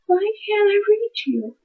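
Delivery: high, slow, soft mock-whine; whiny plea to a book. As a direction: plead with the book, mock despair. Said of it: "and I'm just like".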